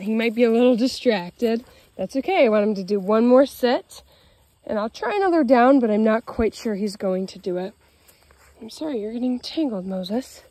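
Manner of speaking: usual speaking voice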